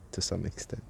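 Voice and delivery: ordinary talk, nothing marked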